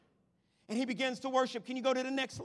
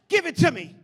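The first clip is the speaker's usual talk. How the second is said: loud divine demand; God calling; 2 semitones higher, 10 dB louder than usual. Acted. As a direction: shout it as God's call. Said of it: "God's saying".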